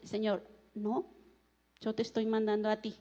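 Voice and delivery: low, hushed reply from God; quiet divine firmness. Acted. The Lord sending the reluctant preacher, low and quiet. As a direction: drop well down for a soft, quick command.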